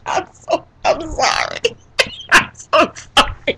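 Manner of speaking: real laughter breaking into the words; laughing apology. Real, not acted. Host apologising for cracking up, laughing. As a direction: apologise slowly through a laughing fit.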